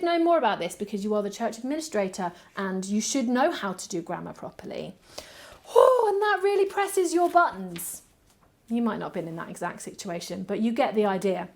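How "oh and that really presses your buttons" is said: high, louder mock exasperation; a hammed-up cry of irritation at the nitpicker; exasperated outburst. Tired church administrator fuming at a nitpicking email, mock exasperated.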